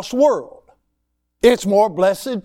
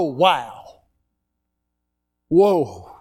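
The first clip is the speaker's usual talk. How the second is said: speechless wonder; 4 semitones lower than usual. Shown as a demonstration. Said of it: "when you can't explain it".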